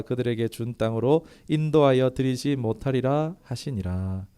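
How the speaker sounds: loud, solemn Bible reading; scripture reading. Acted. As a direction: proclaim the verses to the whole church. Reads scripture aloud to congregation.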